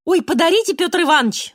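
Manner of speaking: high, loud mock begging; pleading. A narrator's reading. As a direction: beg him eagerly, overdoing it. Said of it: "взмолился Толя".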